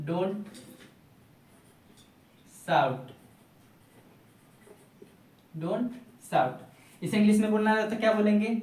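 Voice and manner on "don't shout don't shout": each word set apart with long pauses, like dictation; dictating word by word. Shown as a demonstration. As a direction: say it word by word for students to write. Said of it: "गला मत फाड़ो या चिल्लाओ मत का English क्या होगा".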